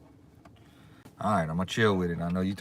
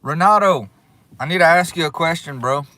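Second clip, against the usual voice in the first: calling out; 7 semitones higher, 7 dB louder than usual. Real, not acted. Calling out to donor.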